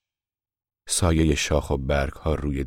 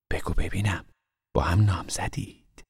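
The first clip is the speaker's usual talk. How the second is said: hushed, whispered question; asked softly; 3 semitones higher than usual. A narrator's reading. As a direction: ask gently, almost a whisper. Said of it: "آهسته پرسیدم". (آهسته و نجواگونه بپرس)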